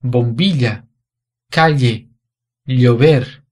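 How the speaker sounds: bombilha, calle, llover with the ll said like Portuguese lh; teacher demonstrating the Spain lh sound in bombilha, calle, llover; Spain pronunciation. Shown as a demonstration. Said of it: "a pronúncia dessas palavras seria".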